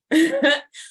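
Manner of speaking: a high, loud burst of laughter; bright laugh. Real, not acted. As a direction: laugh out at the irony. Laughing at the doctor quote.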